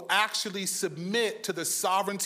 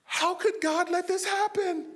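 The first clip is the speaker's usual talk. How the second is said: much higher, lightly mocking question; mock high quote; 9 semitones higher than usual. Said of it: "you never hear her say".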